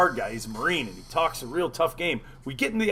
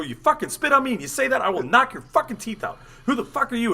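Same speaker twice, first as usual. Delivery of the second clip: higher, heated retold threat; worked-up, pitched-up challenge; angry confrontation; 7 semitones higher than usual. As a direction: re-enact squaring up to a friend.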